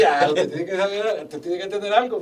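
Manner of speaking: loud laughing back-and-forth, voice pushed up; amused loud retelling of the chat; laughing retold quote. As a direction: replay the exchange while laughing through it. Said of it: "me dice".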